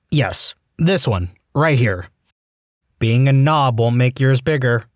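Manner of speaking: ordinary delivery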